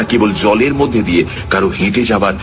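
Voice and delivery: plain reading voice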